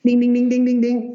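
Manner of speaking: rapid high monotone dinging; imitated bell dinging. Telling of a surge in his body, imitating bells. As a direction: ring out like a bell on one high note. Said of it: "It was like the right bells".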